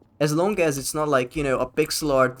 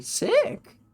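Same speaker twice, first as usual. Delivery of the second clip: much higher, delighted "Sick!"; excited cheer. Real, not acted. Streamer thrilled by a viewer's character design, bright cheer. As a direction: pop it out with sudden glee.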